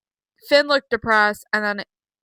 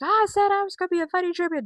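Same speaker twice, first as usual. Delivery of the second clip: high, fast mock Punk voice; a quick high mocking Punk impression; imitating Punk; 9 semitones higher, faster than usual. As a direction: rattle it off high and quick like a cocky champion. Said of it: "Punk's like".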